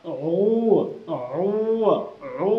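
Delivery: each ও pressed from a tight throat; isolated, constricted Arabic ayn on ও; throat squeezed. Shown as a demonstration. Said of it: "চেপে ধরবো গলাটা".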